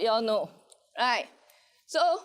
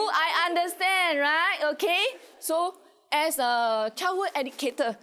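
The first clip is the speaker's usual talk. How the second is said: usual speaking voice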